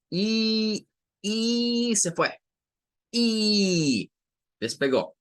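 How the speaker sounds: high, drawn-out rising ee like a plane taking off; long rising plane ee. Shown as a demonstration. Teacher compares the long ee sound to a plane taking off, high and drawn out. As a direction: stretch the ee up high as the plane lifts off. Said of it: "despegue de un avión".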